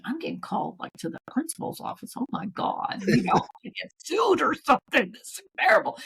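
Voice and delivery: hushed, whispered fear of being sued; mock-scared half whisper; nervous inner panic. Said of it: "And I'm thinking".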